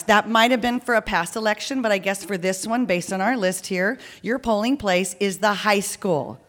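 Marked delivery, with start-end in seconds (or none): none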